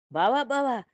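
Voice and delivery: high, loud cry for the brother-in-law; alarmed shout. Retold or imitated. Man yelling to brother-in-law across the yard, loud alarm. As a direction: yell out in feigned panic. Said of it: "అని చెప్పి అరిచారు".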